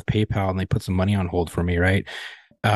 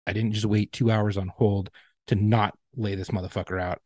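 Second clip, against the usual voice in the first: ordinary delivery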